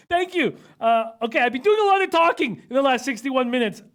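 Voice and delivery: loud, high and emphatic. Real, not acted. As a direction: overcorrect into a near shout. Overcorrects after being called whisperer.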